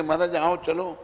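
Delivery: calling out. Acted. Messengers calling the king.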